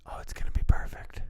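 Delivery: whispering. Real, not acted. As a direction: whisper it with relish. ASMR whisper continues.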